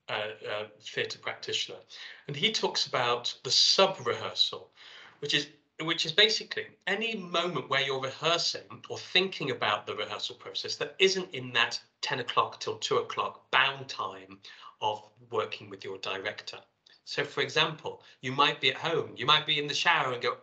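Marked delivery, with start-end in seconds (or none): none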